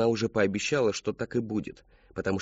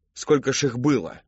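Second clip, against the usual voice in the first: raised, higher demand; angry questioning; 4 semitones higher, 4 dB louder than usual. A narrator's reading. Father interrogating his daughter, raised angry voice. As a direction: fire the question accusingly.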